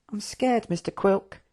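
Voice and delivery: high, small confession; scared. Acted. Hectus confiding fear to Quilk, scared. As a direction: admit it timidly, high-pitched. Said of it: "he admitted".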